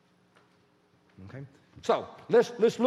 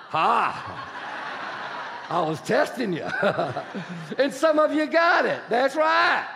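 laughing. Real, not acted. Preacher to congregation after testing them, laughing. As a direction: tease them through a laugh.

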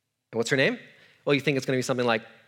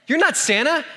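high, laughing disbelief; a little boy finding out the truth; "not" pushed up high in laughing shock; shocked kid; 9 semitones higher than usual. Retold or imitated. Child discovers dad is Santa.

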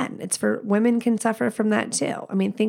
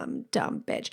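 low whispered self-insult; whispered aside; 11 semitones lower, 9 dB quieter than usual. Real, not acted. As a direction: mutter it under your breath.

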